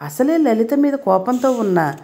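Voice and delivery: ordinary delivery, nothing marked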